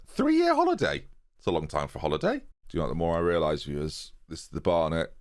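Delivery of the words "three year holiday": mock disbelief. Real, not acted. Mocking manager's long break.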